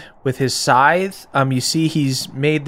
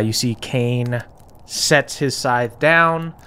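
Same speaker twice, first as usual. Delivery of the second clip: normal talking voice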